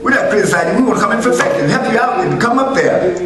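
high, loud, fast preaching; shouting the refrain from the pulpit; full-force preaching. Acted. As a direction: drive it home to the church.

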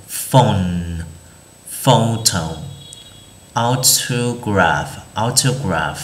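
"phone", "photo", "autograph" slowly, each PH an F. Shown as a demonstration. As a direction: model "phone", "photo", "autograph" with a clear F sound. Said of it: "chúng ta sẽ đọc nó là chữ F". (đọc "phone", "photo", "autograph" với PH thành F)

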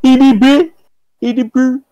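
put-on high British teenage boy voice; squeaky mock British boy, exclaiming; high-pitched British teenager. Shown as a demonstration. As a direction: pitch it up like a British teen lad. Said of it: "very high-pitched British teenage boy".

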